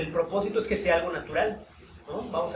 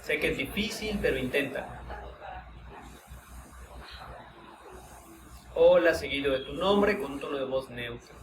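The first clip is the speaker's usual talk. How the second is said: calm, unhurried guiding voice with long pauses; guided meditation; 5 dB quieter, slower than usual. Acted. Teacher guiding students through a voice-tone exercise, meditative. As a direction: lead the group inward through the exercise, gently.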